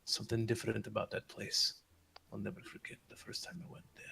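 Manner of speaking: hushed, fading almost to a whisper; hushed recollection. Acted. Old man recalling his first visit to the castle, hushed. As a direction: let the voice drop away into breath.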